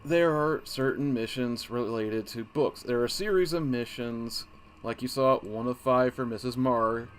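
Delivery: usual speaking voice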